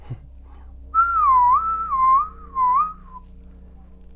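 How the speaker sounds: shrieking siren whistle imitation; alarm-like whistle.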